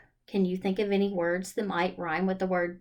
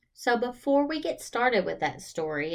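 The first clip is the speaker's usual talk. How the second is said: usual speaking voice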